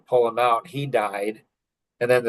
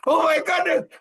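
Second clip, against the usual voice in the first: much higher, hammed-up shrieking wail; dad mimicking his family panicking over burnt cookies, shrill mock crying; mock hysterical wife and daughters. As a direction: parody them melting down over burnt cookies. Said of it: "they're like".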